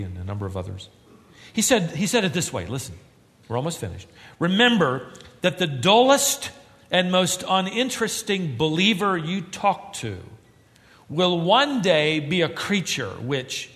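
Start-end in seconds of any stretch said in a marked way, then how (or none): 4.4-13.7 louder, higher, earnest quote; a hint of proclaiming a quote. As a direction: proclaim Lewis's words solemnly. Reads Lewis quote with force.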